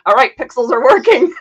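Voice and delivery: amused, breaking into laughter; laughing. Real, not acted. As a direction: laugh while announcing it. Joking about hair, laughing.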